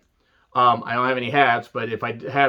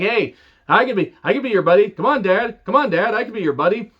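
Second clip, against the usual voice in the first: lightly voiced, higher, wheedling suck-up; mock pleading Grimes; 6 semitones higher than usual. Grimes begging Ted DiBiase to be his buddy, mock pleading. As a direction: coax Dad into being your buddy, a bit higher. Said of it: "Grimes shows up and talks to Ted DiBiase".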